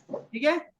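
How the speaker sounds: normal talking voice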